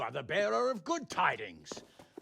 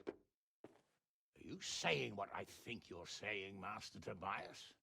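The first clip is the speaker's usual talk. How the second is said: slow, much softer, dangerously quiet question; low slow menace; 6 semitones lower, 11 dB quieter, slower than usual. Acted. Lord quietly menacing the master of the mint. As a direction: let the threat sink in slowly. Lord realises coins are fake.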